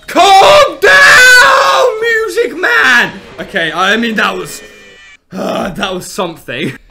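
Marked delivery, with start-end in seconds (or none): 0.1-3.2 very high, loud shriek, slowing; shrieking plea. Real, not acted. Gamer shrieking at the music man, high frantic shout.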